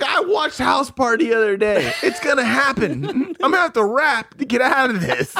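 high, half-laughing panicked self-talk; mock panic. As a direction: act out dread while starting to crack up. Retelling his panicked thoughts in jail.

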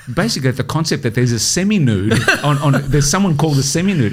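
laughing through the words; real giggles breaking into the explanation. Real, not acted. Telling mates about the absurd job, laughing. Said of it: "I start laughing".

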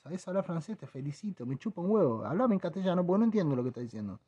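soft unimpressed reply, fast at the end; deadpan mutter. Retold or imitated. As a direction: brush him off under your breath. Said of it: "Y vos decís".

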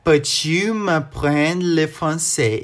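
"Peux-tu m'apprendre le français?" said very slowly, word by word; slow it right down on request. Shown as a demonstration. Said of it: "Fala de novo, bem devagarzinho".

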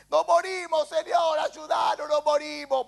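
high-pitched acted pleading; dramatized disciples' desperate wail; panicked cry for help. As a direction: call out as if drowning, without full volume. Voices drowning disciples crying for help.

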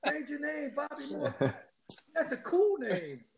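high-pitched mock shouting; retold heckling yell. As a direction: re-enact your own heckle from the stands. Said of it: "[name]'s yelling out".

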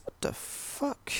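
slow, whispered disbelief; a drawn-out whispered what the fuck; stunned whisper. Real, not acted. Baffled by the movie's logic.

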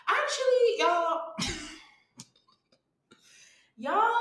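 jumps high and loud, calling out to everyone; excited call-out. Real, not acted. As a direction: shout it out to the listeners with a laugh. Calling out the audience.

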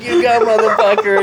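loud, high-pitched egging on; mock hype cheer. Buddy egging him on over phone, mock excited cheer.

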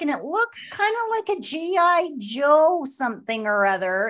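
high, drawn-out imitation of a caller; [name] reporting in. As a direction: stretch out each detail in a lifted storytelling voice. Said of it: "And she's like".

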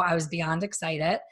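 ordinary delivery

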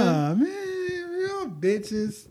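laughing. Real, not acted. Laughing outburst.

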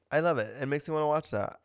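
ordinary talk, nothing marked

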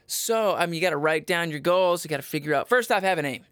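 ordinary talk, nothing marked

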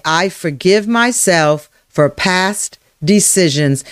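slow affirmation with spaced phrases; spoken affirmation. Shown as a demonstration. Coach leading listeners to say it aloud, slow and deliberate. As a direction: say it slowly and deliberately. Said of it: "say it with your voice".

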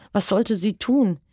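ordinary delivery, nothing marked

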